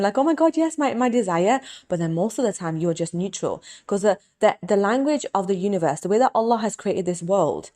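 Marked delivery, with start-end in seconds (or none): 0.2-1.6 higher, bright burst of delight; a quick thrilled gasp of joy; moment of excitement. Pretend. Showing a moment of excitement over her desire, higher. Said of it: "you can have moments of excitement".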